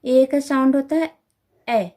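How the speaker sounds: ए said as short a sound; teacher demonstrating the phonic sound ए; phonic sound demo. Shown as a demonstration. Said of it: "सबसे पहले आता है ए". (Phonic sound ए साफ़ बोलकर सिखाना)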